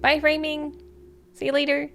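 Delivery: high, singsong goodbye; cheerfully unhinged; bright, high call-out; manic cheerful farewell. Acted. Deranged prisoner waves goodbye.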